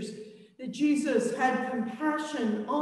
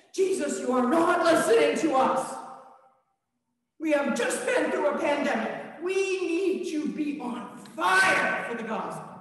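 exasperated shout; 3 semitones higher than usual. Acted. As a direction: shout that he isn't listening. Disciples shout at Jesus.

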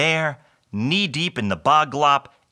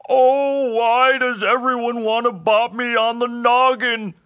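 high, loud blubbering creature voice; hammed-up slobbery wail; sobbing hobgoblin; 12 semitones higher, 5 dB louder than usual. A narrator's reading. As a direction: bawl it out like a wounded hobgoblin. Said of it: "the hobgoblin slobbered".

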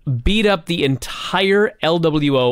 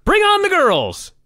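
high-pitched announcer's rallying cry; mock scream. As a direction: cry it out high, as if pounding the desk. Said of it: "and then screaming".

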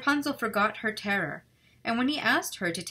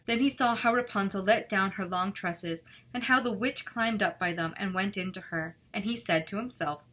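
ordinary delivery, nothing marked

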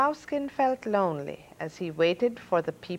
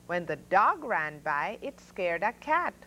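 plain reading voice

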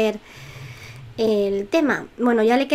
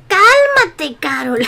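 shrieking, much higher and louder; hysterical shout; 6 semitones higher, 8 dB louder, much slower than usual. Acted. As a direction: yell at her in mock hysterics. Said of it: "Yo y mis histerismos".

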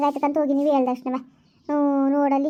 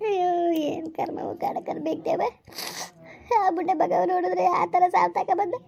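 keen over her fate, voice breaking high; crying lament; 3 semitones higher than usual. Acted. Woman wailing to her friend about her husband, sobbing.

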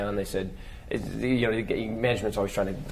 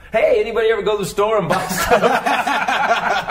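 higher, louder, slow cheesy club-comic setup; hacky old comic; 10 semitones higher, 5 dB louder, slower than usual. Retold or imitated. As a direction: ham up the corny opener, laughing. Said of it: "so I drop into my old stuff".

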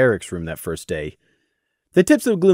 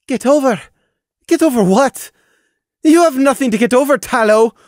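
loud, high, biting stress; furious hiss at her brother; hissing anger; 12 semitones higher, 6 dB louder than usual. A narrator's reading. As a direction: spit his words back. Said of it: "stressed the word into a hiss".

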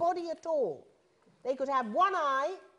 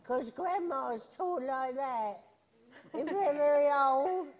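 grandma voice. Shown as a demonstration. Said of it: "That's my grandma voice".

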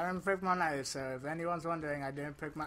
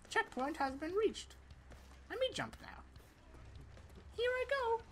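high, bouncy put-on soldier voice; Mason mode; 3 dB quieter than usual. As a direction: play a cheerful, goofy Mason picking off enemies. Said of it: "Mason, activate mode".

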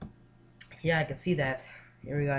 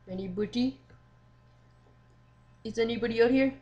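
raised, higher voice calling into the dark, uneasy; nervous calling out; 4 semitones higher than usual. Pretend. Player calling into the dark hallway, nervously raised voice. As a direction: call out as if scared no one answers.

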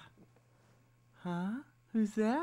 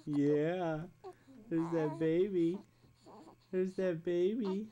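ordinary talk

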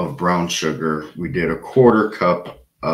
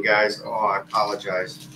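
usual speaking voice

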